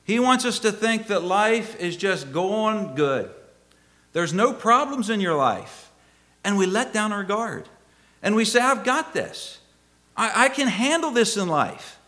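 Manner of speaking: high, insistent pulpit delivery; preaching. Real, not acted. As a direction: press the warning on the congregation, stretching key words. Preaches with force.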